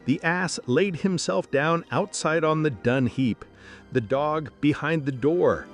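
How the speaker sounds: usual speaking voice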